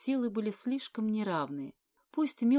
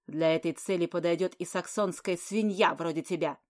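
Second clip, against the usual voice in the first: loud, slow contemptuous sneer; mock her with cold contempt; cynical sneer; 4 dB louder than usual. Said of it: "циничная усмешка".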